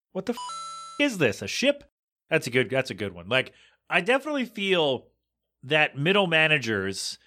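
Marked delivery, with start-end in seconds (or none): 0.1-1.8 a high whispered "what the f-", then a startled "A ship?" way up high; mock disbelief. Real, not acted. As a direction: play it as a stunned jokey double take. Mocking all hands meeting.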